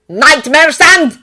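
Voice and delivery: put-on slow, loud Russian-accented alarm; shout. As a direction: bellow the warning across the roofs. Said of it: "Nick shouted to him".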